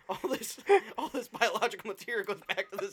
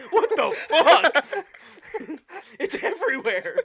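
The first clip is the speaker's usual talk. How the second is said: loud, slow, high disbelief; shocked; 9 semitones higher, 8 dB louder, slower than usual. Pretend. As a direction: recoil at the scene. Acted shocked investigator.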